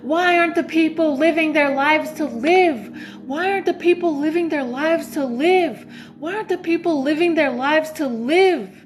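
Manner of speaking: frustrated pleading. Retold or imitated. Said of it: "getting so frustrated".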